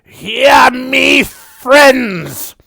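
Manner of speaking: high, booming proud goblin shout; a proud goblin bellows a warning; goblin's loud warning. Acted. Goblin shouts warning to the party, high and booming. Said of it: "he repeats".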